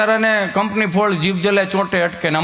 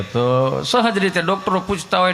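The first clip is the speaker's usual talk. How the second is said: usual speaking voice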